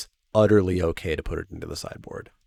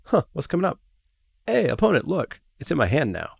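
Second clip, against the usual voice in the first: much higher, playful taunt at the opponent; teasing player; 7 semitones higher, faster than usual. Pretend. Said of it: "just to be like".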